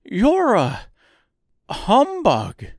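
high, loud scarecrow accusation; grieved accusation. A narrator's reading. Scarecrow denouncing the false wizard, grieved tone. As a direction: accuse loudly in a high, aggrieved voice. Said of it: "in a grieved tone".

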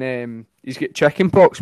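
ordinary talk, nothing marked

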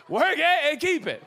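loud, high mock-pulpit slogan; preacher parody. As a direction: hammer it out like a revivalist. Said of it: "I love preachers".

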